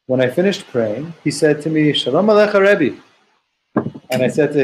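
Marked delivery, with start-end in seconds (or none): 1.9-3.0 lightly voiced prophet, pitched higher; a light hint of the prophet Eliyahu; Eliyahu's greeting. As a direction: greet him as Eliyahu would, raised and bright. Eliyahu greets Rabbi Yossi in story.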